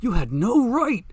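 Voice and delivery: indignant outburst, high and loud; slow, seething protest, pitched high and loud. A narrator's reading. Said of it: "Zelda McIntyre seethed".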